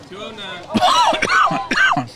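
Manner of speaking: sudden sharp cough on a bug; coughing fit. Real, not acted. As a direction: hack and cough, caught off guard. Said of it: "Must have swallowed a bug there".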